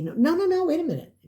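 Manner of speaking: high, quick "no, no, no" cutting in; eager clerk. Retold or imitated. Clerk at lost and found stops the customer, high and eager. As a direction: jump in before she leaves.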